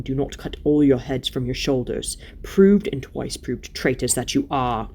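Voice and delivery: low, formal royal pronouncement; stern King Tyrion, rebuking. Acted. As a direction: declare it gravely as a lawful king.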